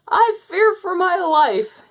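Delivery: slow, high, loud pained king; croaking in pain. Acted. The fallen king tells his sons, slow, high and loud. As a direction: gasp it out, hurt and frightened. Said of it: "The king croaked in pain".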